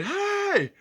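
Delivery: high, loud strained "hey" yell; imitated calling out. Telling how the motel neighbour kept calling, imitated high yell. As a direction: holler it high like the man outside.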